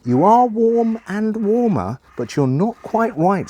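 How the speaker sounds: high, drawn-out teasing hint; string the hint out in a high voice; playful grandma. Acted. Old lady hinting to the boy, playful and high. Grandma hints in guessing game.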